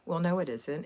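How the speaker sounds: usual speaking voice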